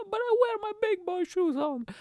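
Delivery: hammed-up whiny mock crying, high. Comedian protesting his height, mock whiny crying. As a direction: whine like a hurt kid. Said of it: "I'm gonna be crying".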